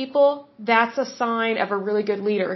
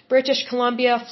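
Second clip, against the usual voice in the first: normal talking voice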